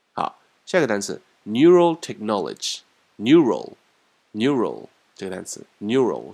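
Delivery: normal talking voice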